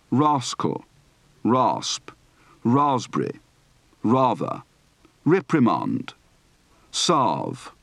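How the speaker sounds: long ah in "Pass", "plant", "tomato"; "paragraph" ending in GRAHF; broad open-throated A. Shown as a demonstration. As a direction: list each word with open ah, "rather" as RAH-thuh. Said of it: "Practice for the broad, open-throated A".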